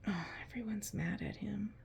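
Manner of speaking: put-on hushed aside to the children; whisper. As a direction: whisper it like a secret. Reading picture book to kids.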